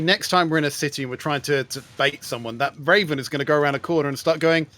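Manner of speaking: ordinary talk, nothing marked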